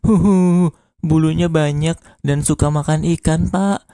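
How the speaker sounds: hammed-up high sobbing; mock tearful whine; crying. A narrator's reading. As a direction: wail like a child who lost something. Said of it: "Mamat yang sedang nangis".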